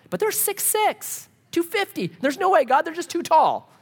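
very high, pleading excuse; hammed-up fearful objection; protesting spies. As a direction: plead helplessly in a squeaky-high voice. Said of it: "That's the evil report".

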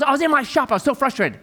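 put-on frustrated young man. Preacher imitating a frustrated young man complaining about his shop.

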